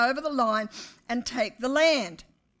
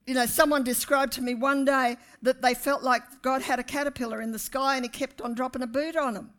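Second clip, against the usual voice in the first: ordinary delivery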